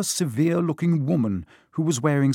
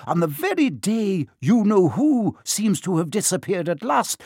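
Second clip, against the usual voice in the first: higher, crisp stern Scottish teacher; a prickly old witch caught out, higher; startled stern witch, pitched up; prim Professor McGonagall; 8 semitones higher, 5 dB louder than usual. Acted. McGonagall worries aloud.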